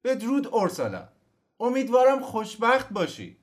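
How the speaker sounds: high, loud farewell call; shouting farewell. Acted. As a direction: call after the carriage. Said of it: "کوزیمو فریاد زد".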